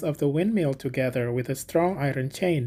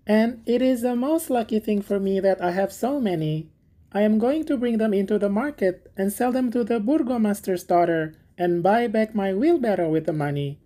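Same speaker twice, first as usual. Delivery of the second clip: higher, eager simple gardener voice; little Hans; 6 semitones higher than usual. Acted. Hans thanking the miller for asking, high and eager. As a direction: play Hans as a humble, grateful friend. Hans explains his plans.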